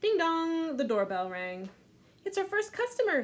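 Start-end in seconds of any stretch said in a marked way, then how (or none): 0.0-0.8 higher, louder doorbell chime; doorbell ding dong. Acted. Doorbell imitation. 2.2-3.2 very high excited announcement; excited girl. Acted. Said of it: "said Louise".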